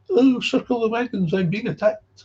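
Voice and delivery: mock their defensive squeal; mocking whine. Mocking sponsors who feel attacked, high whining parody. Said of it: "this Scottish prat comes up".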